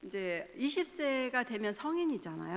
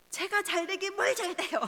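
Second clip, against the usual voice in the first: put-on high, louder retort of an irritated teen; mock angry retort; 9 semitones higher, 5 dB louder than usual. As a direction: snap back like a sulky teenager. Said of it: "반박하면서 화를 냈어요".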